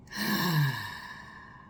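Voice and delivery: low sigh from the throat. Shown as a demonstration. Therapist demonstrating a better sigh, low throat sigh. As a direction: drop the sigh down into the chest. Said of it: "throat and yeah".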